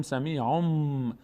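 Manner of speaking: سمیعون ending in a long hummed m, sami'omm; n turned to m. Shown as a demonstration. Teacher shows the rule, سمیعون slow with m for n. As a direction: draw out a final m in سمیعون, no n.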